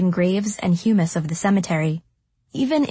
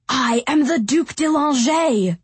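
higher, enraged declaration; furious shout; 6 semitones higher than usual. A narrator's reading. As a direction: roar it with trembling rage. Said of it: "trembled under the weight of his rage".

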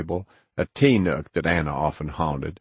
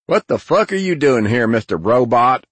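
high, loud needling question; brash greeting; 6 semitones higher, 6 dB louder than usual. Acted. Narrator greets his old friend in the kitchen, brash and loud. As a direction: call out a rude, ribbing hello.

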